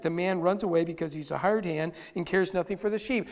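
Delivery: ordinary delivery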